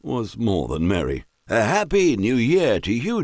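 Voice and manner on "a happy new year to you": tipsy squire. A narrator's reading. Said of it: "proclaimed Squire Gibson, enthusiastically, but with slightly slurred speech".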